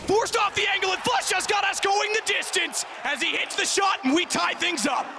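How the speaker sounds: high hyped shouting; excited shout. Real, not acted. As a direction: roar as the round ties. Caster hypes tying round.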